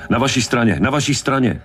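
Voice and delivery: high-pitched urgent cry; frantic repeated alert, not full volume; shouted warning. A narrator's reading. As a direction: call it out urgently, voice pitched right up. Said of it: "křičel Hooper".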